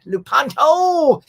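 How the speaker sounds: drawn-out, loud, high-pitched hail; big stretched-out shout-out to a buddy; calling out a name. Real, not acted. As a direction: holler the name long and high. Hails a fellow streamer by name.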